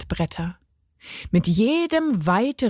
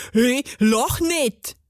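slow, higher, indignant gnome voice; hissed gnome protest; 4 semitones higher, slower than usual. A narrator's reading. Gnome tells the grinning girl off, cross. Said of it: "zischte der Zwerg".

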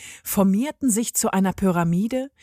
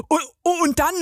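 eager call; 8 semitones higher than usual. A narrator's reading. Ball in the back row calling out, high and eager. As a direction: blurt the question up high. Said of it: "rief eine Kugel".

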